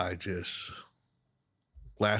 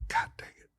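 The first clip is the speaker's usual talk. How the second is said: under-the-breath curse; whispered curse; 5 dB quieter than usual. Real, not acted. Missing the cast with the fish in sight, a whispered curse. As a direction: swear at yourself in a whisper. Short cast, cursing to himself.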